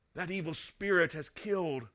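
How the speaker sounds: ordinary talk, nothing marked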